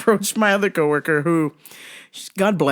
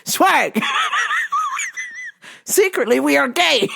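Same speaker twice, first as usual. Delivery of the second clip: higher, projected mock announcement; open punchline. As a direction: blurt the aside out high, breaking into laughter. Repeats the aside as open punchline.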